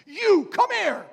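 put-on the wild prophet summons the young man from the aisle, booming; booming prophet call. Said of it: "and he goes".

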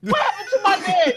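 loud, high, laughing through the yell; laughing mock shout. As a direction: shout it while cracking up. Repeats quote, laughing.

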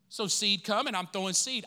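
ordinary talk, nothing marked

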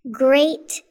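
usual speaking voice

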